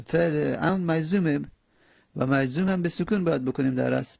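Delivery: ordinary delivery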